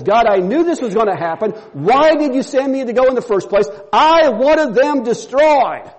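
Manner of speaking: mad at God. Acted. Jonah complaining to God, angry and bitter. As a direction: build to a bitter outburst. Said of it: "He gets mad at God and says".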